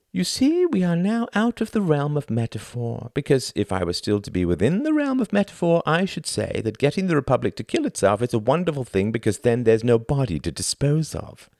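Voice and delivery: high, showy stage-host voice; theatrical thin man. Acted. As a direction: play the pompous thin performer lecturing the audience. Thin man addresses audience.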